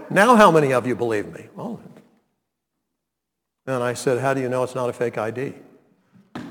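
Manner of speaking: normal talking voice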